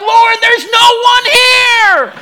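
very high, stretched-out exasperated yell; hammed-up husband shouting in protest; yelling back. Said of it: "we start yelling at each other".

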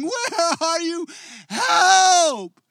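high, slow, then loud desperate calling; screaming plea. Retold or imitated. Shouting to an absent God at night, high desperate scream. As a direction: call out into the dark for God. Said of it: "silence and screaming".

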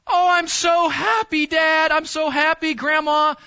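put-on high, loud, gushing child's delight; excited child. Child opening a Christmas present thanks dad and grandma, thrilled. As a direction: squeal it like a kid opening gifts. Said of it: "and they go".

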